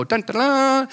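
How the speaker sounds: high trumpeting announcement; hammed-up angelic fanfare; Gabriel's fanfare. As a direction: sing out a heavenly herald's proclamation. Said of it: "gonna come out and go".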